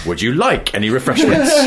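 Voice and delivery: like swung high and stressed. Retold or imitated. Imitating train trolley man, stress on like. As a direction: punch the word like, pitch climbing. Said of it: "moving the emphasis down the sentence".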